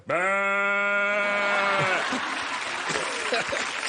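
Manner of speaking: long steady bleat; goat voice. Shown as a demonstration. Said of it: "comment on fait la chèvre".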